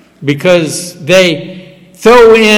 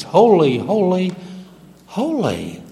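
holy, holy, holy fading softer each time; reverent hush; 3 semitones higher, 6 dB quieter than usual. Shown as a demonstration. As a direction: let each holy sink quieter. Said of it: "even three times".

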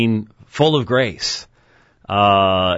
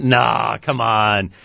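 ordinary talk

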